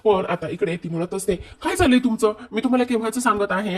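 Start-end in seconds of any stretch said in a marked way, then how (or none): 1.4-3.8 higher, louder imitated chiding; acting out an exasperated wife. Wife chiding her inattentive husband, exasperated. Kavya chides inattentive Parth.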